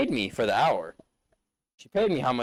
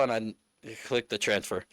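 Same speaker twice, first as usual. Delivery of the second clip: usual speaking voice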